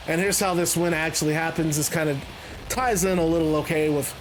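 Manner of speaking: normal talking voice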